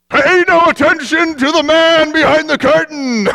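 put-on flustered old man begging them to look away; caught wizard. The exposed wizard covering himself, high and loud. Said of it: "and he said".

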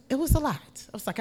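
usual speaking voice

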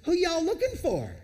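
playful high mock question; teasing Elisha. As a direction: tease them, having fun. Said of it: "Elisha goes up and he says".

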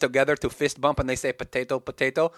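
put-on Russian accent; comic Russian voice. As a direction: explain the slang in a mock Russian accent. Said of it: "we talk in a Russian accent".

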